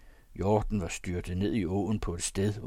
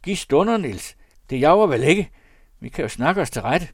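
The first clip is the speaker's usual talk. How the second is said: high, loud plea for time; råbende; 7 semitones higher, 10 dB louder than usual. A narrator's reading. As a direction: plead loudly with the gunman. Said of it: "råbte delinkventen". (højlydt, bedende råb)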